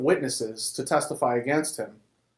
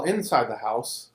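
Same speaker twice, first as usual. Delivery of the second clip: usual speaking voice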